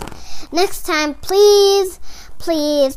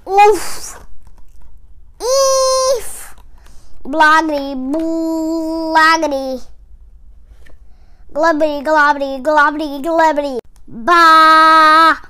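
loud cartoon babble; silly nonsense sounds; 5 dB louder, slower than usual. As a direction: blurt each nonsense word like a cartoon. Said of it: "make silly sounds like".

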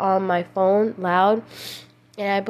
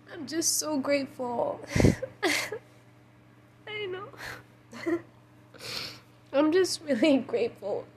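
high, very soft voice, crying; real tearful gratitude; 5 semitones higher, 7 dB quieter than usual. Real, not acted. Speaker thanking God, tearful and overwhelmed. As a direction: choke up softly, pitched high with long pauses. Breaks into tears while giving thanks.

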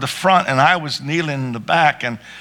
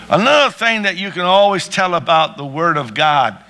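ordinary delivery

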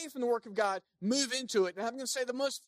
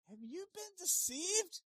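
scornful Pharisees; 6 semitones higher, 4 dB louder than usual. Retold or imitated. Pharisees mocking the officers, high scornful sneer. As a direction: sneer at the gullible. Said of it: "Pharisees' answer".

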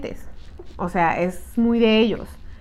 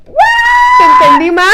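very high, slow, louder offended question; mock outrage; 9 dB louder, much slower than usual. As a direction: react as if deeply insulted, drawing it out high.

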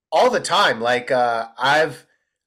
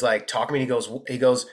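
ordinary talk, nothing marked

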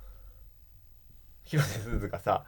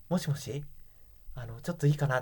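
shy Suzu call; 2 semitones higher, 7 dB quieter than usual. Retold or imitated. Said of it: "広瀬すずが、".

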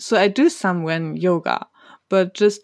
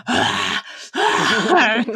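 exasperated groan; 3 semitones higher than usual. Retold or imitated. Groaning at meditation voice.

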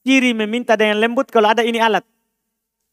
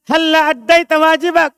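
higher, pushy demanding tone; forceful demand; 8 semitones higher, 4 dB louder than usual. Shown as a demonstration. Teacher acting a stern demand to a student, pushy. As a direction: press them hard to do their duty. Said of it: "Dia bilang nadanya".

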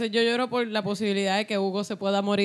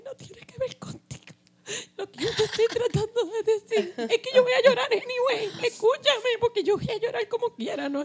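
high, wailing mock sob; mock crying; 12 semitones higher than usual. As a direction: ham up a tearful breakdown. Mock tearful rant.

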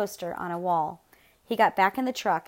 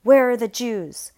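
loud, slow, higher-pitched demand; barked; 4 semitones higher, 8 dB louder, slower than usual. A narrator's reading. Gestapo agent bursting in on family, loud bark. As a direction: bark it out slowly as an order. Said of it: "the agent barked".

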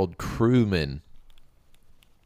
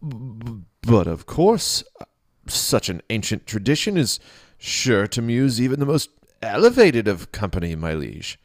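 stammering nervous priest; 6 semitones higher, 6 dB louder than usual. Acted. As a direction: stutter it out, scared of the king. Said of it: "Ichabod stammered".

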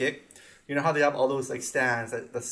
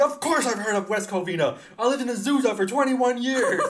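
indignant, higher and louder retort; mock-offended old vendor; defensive retort; 9 semitones higher, 5 dB louder than usual. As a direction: imitate him snapping back, offended. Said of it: "Why are you so defensive".

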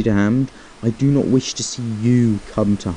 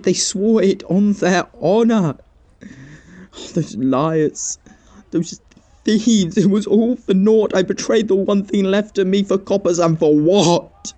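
anguished remorse; 6 semitones higher than usual. Acted. Confesses the betrayal to Edwin.

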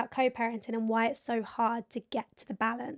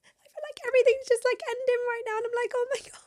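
teary; 8 semitones higher, faster than usual. Real, not acted. Emotional goodbye, tearing up.

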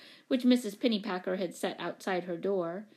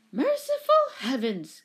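high, louder shocked exclamation; gasped; 5 semitones higher, 5 dB louder than usual. A narrator's reading. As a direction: gasp it in horror. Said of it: "gasped the woman".

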